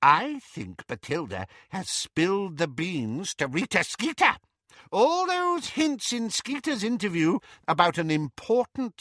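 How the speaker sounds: put-on high, gossipy old woman voice; fussy old aunt. A narrator's reading. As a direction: play a nosy old aunt.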